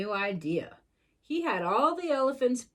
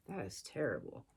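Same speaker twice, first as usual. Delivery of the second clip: sorry aside; 11 semitones lower, 11 dB quieter than usual. Real, not acted. Aside to child, very low.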